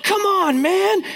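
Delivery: very high exasperated protest; fan complaint. Retold or imitated. Dad retelling his outburst at a missed call, very high. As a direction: gripe at a bad call. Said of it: "I'm like".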